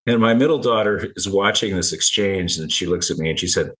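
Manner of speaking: ordinary talk, nothing marked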